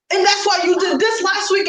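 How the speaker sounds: high, sudden accusing outburst; hammed-up accusing shout; mock angry boyfriend. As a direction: imitate him blowing up over old grudges. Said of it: "he's like".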